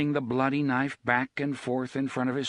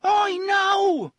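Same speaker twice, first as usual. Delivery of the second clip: much higher, loud scream; 9 dB louder than usual. Acted. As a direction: scream the warning. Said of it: "he screamed".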